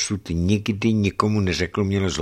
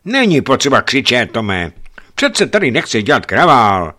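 wife voiced; 5 semitones higher, 7 dB louder than usual. Greg voices wife scolding him.

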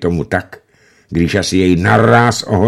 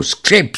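creaking prosthesis; 11 semitones higher than usual. A narrator's reading. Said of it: "protézy skřípou".

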